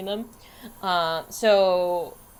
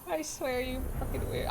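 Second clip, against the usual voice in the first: ordinary talk, nothing marked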